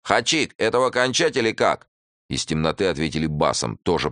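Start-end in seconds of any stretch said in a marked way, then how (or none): 0.0-1.9 high, loud call to the boss; shouted question. A narrator's reading. Bandit calls out to Khachik with a loud shout. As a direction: bandit shouts across the dark. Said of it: "крикнул по-русски".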